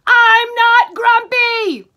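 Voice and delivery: loud, high, slow angry shout; yelled. Acted. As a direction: bellow it out like a furious chimp. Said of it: "Yell it!".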